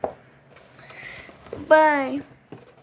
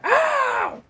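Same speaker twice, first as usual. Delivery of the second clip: high sharp "ow", much higher; yelp of pain; 12 semitones higher than usual. Acted. Man hit by surprise, high pained yelp. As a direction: yelp as if something hit you.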